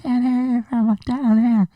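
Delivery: mock her cheerful tease; teasing climbing lady. Climbing lady teases the scared narrator from the wall, high and playful.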